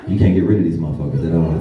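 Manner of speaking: ordinary delivery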